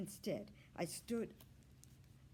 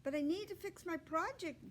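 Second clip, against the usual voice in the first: put-on higher, whiny boy voice; boy protesting; 10 semitones higher than usual. A narrator's reading. Boy protesting to his mom about breakfast. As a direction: plead to stay with his project. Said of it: "he replied".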